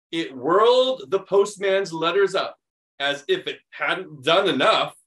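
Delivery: animated picture-book reading; reading to children. Acted. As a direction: read it like story time for kids. Reads picture book aloud.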